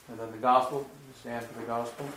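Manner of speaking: normal talking voice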